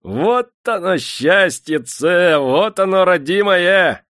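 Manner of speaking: high, loud, drawn-out rejoicing; gleeful cackling cry. A narrator's reading. Half-mad Prokofy dances round the flogging, shrilly gleeful. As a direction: crow it out in frenzied delight. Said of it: "хихикал".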